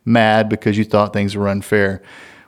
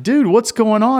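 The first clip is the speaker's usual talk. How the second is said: alarmed call; 12 semitones higher than usual. Retold or imitated. Dad calling to son after slam.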